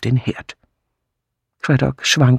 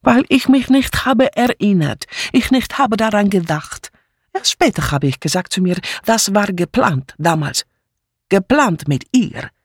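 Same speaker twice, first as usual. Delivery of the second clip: higher, jumpy, insistent broken German; agitated refugee maid; 7 semitones higher than usual. Acted. Mitzi insisting to the inspector, agitated and defiant. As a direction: pour out the accusation, wounded.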